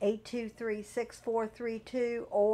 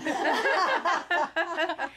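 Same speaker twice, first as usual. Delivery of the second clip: hearty laugh; 8 semitones higher than usual. Real, not acted. Guest laughs at own joke.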